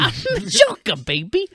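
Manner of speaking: squeaky high mock villain; very high, cartoonish parody; Joker impression. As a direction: echo it in a silly falsetto. Echoes the friend's Joker bit.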